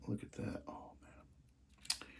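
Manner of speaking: hushed, breathless whisper; awed whisper. Real, not acted. To viewers, whispering in awe at the train's front end. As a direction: barely breathe the words in wonder. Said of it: "I get really emotional and".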